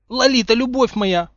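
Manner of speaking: high, loud theatrical moan. A narrator's reading. Harry to the woman gripping his ear, moaning. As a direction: moan it like a lovesick ham. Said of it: "простонал Гарри".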